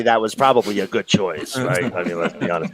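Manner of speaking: put-on light Brooklyn accent, New York attitude. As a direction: talk like a guy from Brooklyn. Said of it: "why the Brooklyn accent?".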